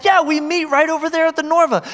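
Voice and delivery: high-pitched, enthusiastic reply; eager confirmation. Retold or imitated. Pastor telling two girls where church meets, high and eager. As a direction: keep it high and keen. Retold excited invitation.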